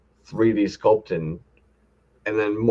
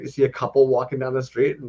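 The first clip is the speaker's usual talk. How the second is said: normal talking voice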